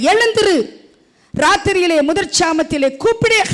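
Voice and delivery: loud, high, impassioned scripture reading; full-force preaching. Acted. Pastor urging the church to weep, preaching loud and high. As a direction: cry the verses out to the congregation.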